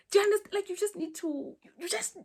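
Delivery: high and loud, breaking into a laugh. Real, not acted. Telling viewers to fake confidence, laughing. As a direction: laugh through the words. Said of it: "laughs at herself".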